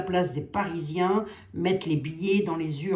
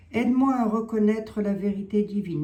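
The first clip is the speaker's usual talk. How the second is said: prayer; 4 semitones higher, 6 dB louder than usual. Acted. Continues the prayer.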